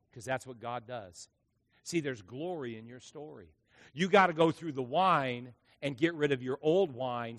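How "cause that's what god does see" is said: ordinary talk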